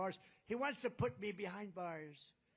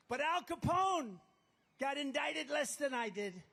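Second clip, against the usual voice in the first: booming announcement; 7 semitones higher, 4 dB louder than usual. Real, not acted. Candidate compares himself to a gangster, booming proclamation. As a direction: proclaim the name to the back rows. Rally punchline called to crowd.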